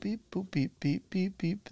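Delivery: quiet, high mock computer beeps; beep boop noises. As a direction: softly beep like a computer searching. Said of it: "I'll look it up real quick".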